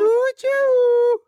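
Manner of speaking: very high, slow squeaky puppet voice; a creepy clown puppet teasing slowly; squeaky ventriloquist dummy. Acted. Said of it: "this voice".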